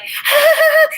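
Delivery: high, loud fake laugh; mock cackle. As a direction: cackle like a starstruck fan. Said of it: "the woman, like".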